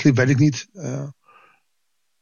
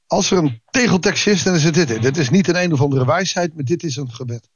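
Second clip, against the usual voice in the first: normal talking voice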